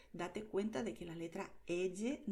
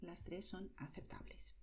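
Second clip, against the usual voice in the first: unvoiced whispered aside; quiet whisper; 9 dB quieter than usual. Shown as a demonstration. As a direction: whisper it to reassure. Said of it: "whispered aside".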